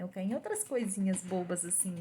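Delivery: ordinary talk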